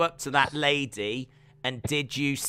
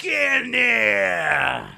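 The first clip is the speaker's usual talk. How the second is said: very drawn-out New York shout; high, hammed-up New York holler; New York accent; 4 semitones higher, much slower than usual. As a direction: stretch it out in a mock New York yell. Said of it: "I'm from New York".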